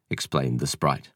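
usual speaking voice

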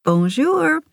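high, lilting greeting swooping upward; showy French greeting. A narrator's reading. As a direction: sing it out brightly to show off your French. Showing off French to mother.

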